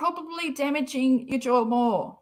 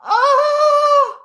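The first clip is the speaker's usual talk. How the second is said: loud, high sudden gasp of shock; shocked cry; 12 dB louder than usual. Real, not acted. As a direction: blurt it out in real shock.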